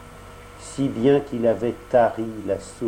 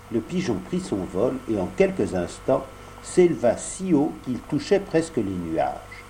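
ordinary delivery

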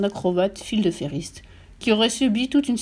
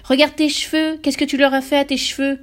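high, loud yelping outcry; shocked mother crying out at her husband; cri glapissant; 6 semitones higher, 5 dB louder than usual. A narrator's reading. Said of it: "glapit la mère". (glapissement affolé, aigu)

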